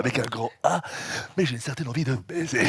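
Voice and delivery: very low, deep put-on politician voice; Chirac voice. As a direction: drop the voice deep and grave like an old statesman. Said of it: "Fais, fais un petit peu de Chirac".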